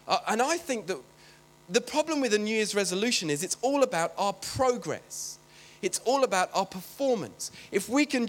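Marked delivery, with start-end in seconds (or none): none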